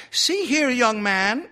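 high, loud scolding librarian; stern lady librarian telling off a youngster. A narrator's reading. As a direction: pull him up sharply, high and loud. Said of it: "said Miss Sigsbee sternly".

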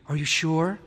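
tight, uneasy question; tense. A narrator's reading. Wife asks husband about the lions, tense. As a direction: ask it wound tight with worry. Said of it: "His wife sounded peculiarly tense".

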